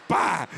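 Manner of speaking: loud, explosive slam noise; imitated door slam. Husband mimicking the bedroom door his wife slammed, explosive. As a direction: burst it out like a door banging.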